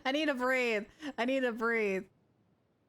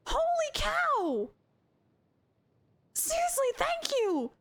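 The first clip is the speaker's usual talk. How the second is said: high, stretched-out astonishment; amazed gratitude; 12 semitones higher, slower than usual. Real, not acted. Streamer thanking a generous viewer, amazed. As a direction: squeal it, drawing out the amazement. Stunned by surprise gift.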